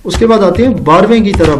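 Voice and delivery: usual speaking voice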